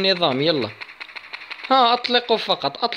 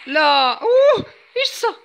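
startled; 11 semitones higher, 4 dB louder than usual. Real, not acted. Something sudden happens in game.

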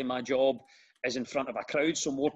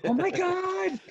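high, louder gushing exclamation, hammed-up; mock American excitement; 4 dB louder, slower than usual. Comic mimicking enthusiastic American crowd at hearing he's Scottish, mock excited squeal. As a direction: squeal it like an overexcited American fan, mockingly.

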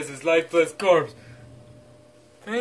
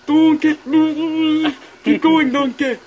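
high, loud yell for more; excited yell; 9 semitones higher, 6 dB louder, slower than usual. Pretend. Shrek yells at Donkey to keep pouring ketchup, high and loud. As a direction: whoop it up to keep him pouring.

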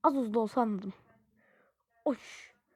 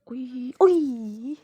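loud yelp; 11 dB louder than usual. Real, not acted.

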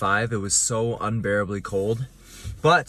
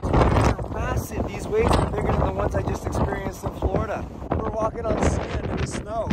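shouting over wind; 9 semitones higher, 4 dB louder than usual. Real, not acted. Windy beach, waves massive.

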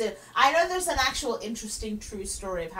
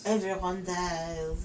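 low, slow, softer French echo; 5 semitones lower, 5 dB quieter, slower than usual. Acted. Co-host echoes the French operator, very French. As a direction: drag it out with a blasé French accent. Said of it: "in the most French way".

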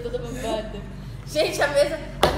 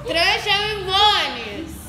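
cheeks stuffed full; 3 semitones higher, 5 dB louder, much slower than usual. Real, not acted. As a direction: mouth crammed with marshmallows. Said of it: "eu tô flácida desse lado".

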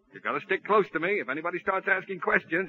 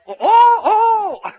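high, loud panicked laughing oh; hysterical laugh; 14 semitones higher, 12 dB louder, much slower than usual. Acted. Man told he is her husband, laughing in panic. Stunned at being called husband.

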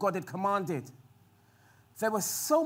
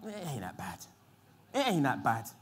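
complacent shrug; 9 semitones lower, 8 dB quieter than usual. Retold or imitated. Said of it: "you just kinda like".

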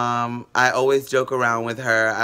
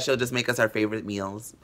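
usual speaking voice

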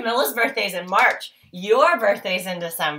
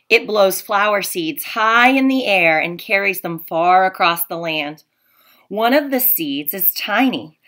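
storybook read-aloud, a little louder, with hushed asides; reading to children; 5 dB louder than usual. Acted. Teacher reading The Tiny Seed to her class. Reads picture book to class.

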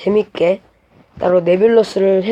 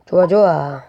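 ordinary talk, nothing marked

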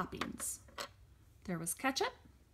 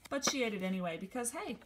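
ordinary delivery

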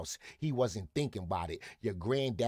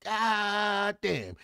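high, drawn-out holler at mama; exasperated shout; 11 semitones higher, 6 dB louder than usual. Acted. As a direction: belt it out, stretching the first syllable. Exasperated exclamation in plea to mama.

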